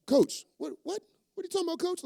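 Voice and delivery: high, shocked protest; incredulous player. Acted. Player protesting to the coach, high and incredulous. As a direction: play a stunned player who can't believe it.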